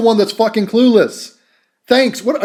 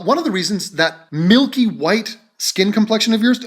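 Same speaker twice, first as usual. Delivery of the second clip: usual speaking voice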